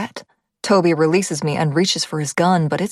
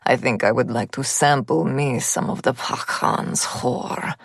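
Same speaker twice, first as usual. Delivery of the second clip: put-on slow, low leering Russian accent; lecherous gunman in a Russian accent; 5 semitones lower, slower than usual. A narrator's reading. Gunman threatens her.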